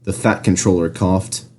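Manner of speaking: usual speaking voice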